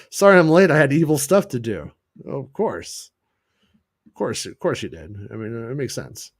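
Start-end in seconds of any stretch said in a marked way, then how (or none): 0.1-1.9 higher and louder mock Satan, breezy about evil. Satan apologizing for being late, mock devilish voice. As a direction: ham up a devil casually apologizing. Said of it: "Star Wars Satan's all".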